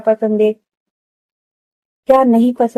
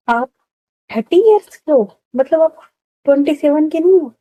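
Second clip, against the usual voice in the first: shocked question; 6 semitones higher than usual. Retold or imitated. Roohani learns Aarohi's real age.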